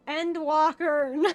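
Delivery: loud, drawn-out mock answer; mock proclamation. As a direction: call it out slowly and loudly, imitating the crowd. Imitates people answering the question.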